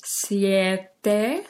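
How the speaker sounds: each number chewed slowly like a cow eating grass. Shown as a demonstration. Teacher showing the class how to chew each number. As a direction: chew every word with exaggerated mouth. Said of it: "Imagínense que son una vaca comiendo pasto".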